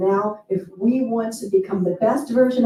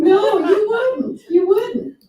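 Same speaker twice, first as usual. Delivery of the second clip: high, slow, laughing no you wouldn't; laughing agreement; 10 semitones higher, 4 dB louder, slower than usual. Real, not acted. Laughing reply to audience.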